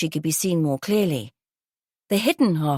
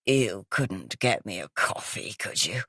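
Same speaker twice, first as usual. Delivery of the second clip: gravelly, smoky voice; 9 semitones lower, 5 dB quieter than usual. Acted. Groggy guest exclaiming to police in a low smoky rasp. Ingratiating croaky woman.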